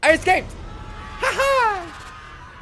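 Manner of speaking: high gleeful cry with laugh; triumphant. Real, not acted. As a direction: crow over the escape. Gloating escape.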